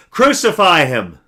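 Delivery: a crowd calling for death; crowd shouting. A narrator's reading. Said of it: "they shouted back".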